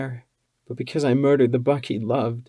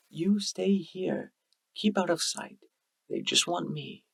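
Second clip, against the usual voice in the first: quiet and resigned; 7 semitones higher, 3 dB quieter than usual. A narrator's reading. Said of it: "U twenty's voice was quiet and resigned".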